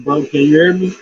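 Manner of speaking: normal talking voice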